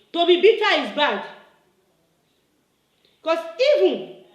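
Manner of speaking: usual speaking voice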